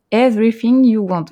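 hammed-up English accent on the book title; mock English accent. Shown as a demonstration. Host announcing the guest's novel in a playful English accent. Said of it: "avec mon super accent anglais".

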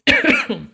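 sudden loud choking cough; coughing fit, caught in the throat. Real, not acted. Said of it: "excusez-moi, je m'étouffe".

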